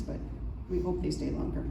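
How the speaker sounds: ordinary delivery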